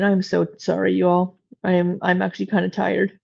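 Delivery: ordinary talk, nothing marked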